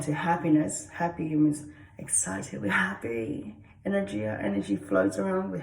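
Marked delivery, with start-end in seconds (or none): none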